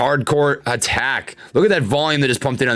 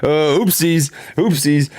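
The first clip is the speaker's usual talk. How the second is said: sheepish oopsies; slower than usual. Real, not acted. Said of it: "I didn't mean to swear".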